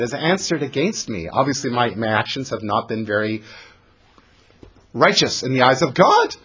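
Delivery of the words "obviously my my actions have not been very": usual speaking voice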